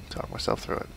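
whispered to himself; whispering. Real, not acted. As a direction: whisper it to calm down.

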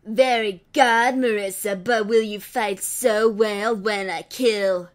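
slow sinister taunt; menacing villain. Acted. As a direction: drag the threat out. Villain threatens husband.

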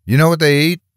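high, fast challenge; husband demanding answers from the stranger, high and fast. A narrator's reading. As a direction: fire the question at him, demanding. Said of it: "he demanded".

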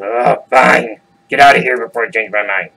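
loud, grudging troll; exasperated troll. Acted. Troll sends goat away, loud and exasperated. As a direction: give in loudly and irritably as the troll.

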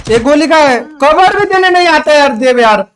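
loud, high-pitched exasperated rant; angry berating. Real, not acted. Player berates teammate for not coming to cover, angry shout. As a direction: shout at teammates who left you alone.

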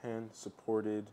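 soft, low commentary for a recording; hushed coach commentary. Acted. Coach explaining a squat on video, hushed commentary. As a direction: keep it quiet and low, spaced out. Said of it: "They're, like, quiet so that".

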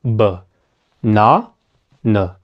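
"banana" split into three low, separate syllables. Shown as a demonstration. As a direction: say "banana" as buh, NA, nuh with pauses. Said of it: "No va a estar aquí, sino aquí".

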